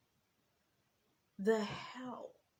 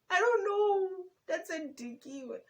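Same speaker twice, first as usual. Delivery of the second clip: high laughing aside; 12 semitones higher, 10 dB louder than usual. Real, not acted. She laughs while retelling the hug request, high and amused. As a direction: laugh it off in disbelief.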